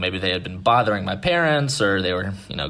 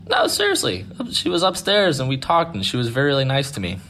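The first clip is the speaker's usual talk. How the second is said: high-pitched child imitation; earnest little boy; 6 semitones higher than usual. Boy swears the girl was upstairs, imitated earnest kid. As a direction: insist innocently like a six-year-old.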